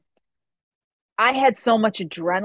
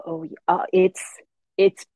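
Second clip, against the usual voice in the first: slow, halting, pained groan; 2 semitones lower, 4 dB louder, slower than usual. Retold or imitated. Fighter with broken nose tells dad: slow pained halting. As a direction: let the pain break the words up.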